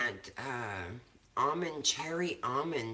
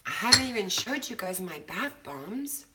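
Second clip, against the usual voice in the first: normal talking voice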